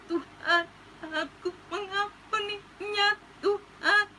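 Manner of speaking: high, breaking voice pushing through tears; tearful prayer. Retold or imitated. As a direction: forgive while crying, barely getting words out. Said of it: "saya cuma nangis". (doa sambil menangis, suara pecah)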